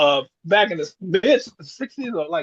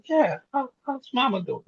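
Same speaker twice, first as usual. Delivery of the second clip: imitating confused mother; 7 semitones higher, 3 dB quieter than usual. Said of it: "She goes".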